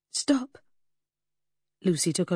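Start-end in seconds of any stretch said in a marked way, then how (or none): 0.1-0.6 sharp stop. Acted. Lucy cutting off Freddy.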